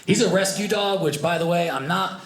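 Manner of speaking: ordinary delivery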